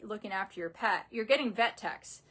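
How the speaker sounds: ordinary talk, nothing marked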